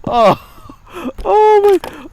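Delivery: loud high laugh into oh my; cracking up helplessly; high squealing laughter. Real, not acted. Vlogger laughing at Brady's farewell.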